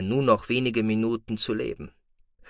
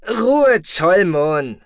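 commander's order; 8 semitones higher, 8 dB louder, much slower than usual. Acted. Commander silencing his ranting deputy with a loud order. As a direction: bark the order from the command chair. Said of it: "ordnete er an".

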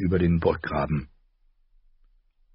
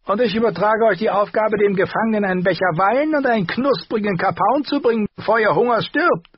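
much higher and louder, mocking "ich"; mocking dwarf voice; 5 dB louder than usual. A narrator's reading. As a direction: echo her order as a sneering joke. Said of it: "sagte Lannister". (hohe, spöttische Nachäffung des Befehls)

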